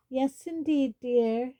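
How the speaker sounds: high, sing-song trill; lilting high greeting. Acted. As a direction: trill it out like a jolly portrait. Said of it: "she trilled".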